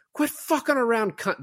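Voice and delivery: high-pitched mock character voice; mimicked film threat. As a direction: put it on high, imitating a film tough.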